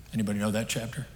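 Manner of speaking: ordinary talk, nothing marked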